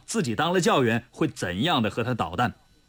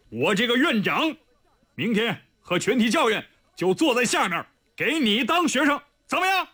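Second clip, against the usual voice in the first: rising, much higher angry rebuke; angry scolding; 5 semitones higher than usual. A narrator's reading. The dean dressing down the arrogant student, angry. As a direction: scold him hotly, climbing in pitch. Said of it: "怒气冲冲地训斥道".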